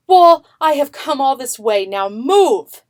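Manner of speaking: loud, firm command to the wall; commanding call. A narrator's reading. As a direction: order the wall to move, full voice.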